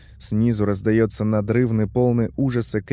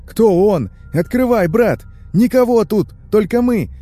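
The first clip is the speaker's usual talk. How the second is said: very high, loud desperate yelling; frantic, pressing demands; urgent shouting; 11 semitones higher, 6 dB louder than usual. A narrator's reading. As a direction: shout insistently through a locked door. Friends shout to be let in.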